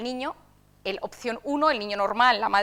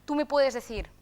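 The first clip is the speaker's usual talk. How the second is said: normal talking voice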